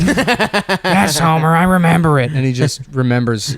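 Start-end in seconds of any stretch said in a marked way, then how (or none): 0.0-2.3 higher, Marge-style reply; cartoon wife voice, higher. Marge's reply in Simpsons bit.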